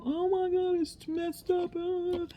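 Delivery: squeaky high panicked wail; mock-wail the disaster in a very high voice; high squealing "oh my God" at the ruined sticker; panicked self. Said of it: "And I go".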